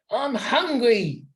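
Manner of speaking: slow, high whine; whining. Acted. Smells whines on the way to the bees, slow high whine. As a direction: drag the whine out, fed up. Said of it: "whined Smells".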